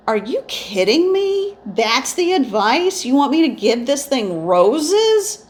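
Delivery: high, slow, stunned disbelief; you can't believe this advice, pitch way up; incredulous. Retold or imitated. Telling her spirit guides she can't believe the advice, incredulous.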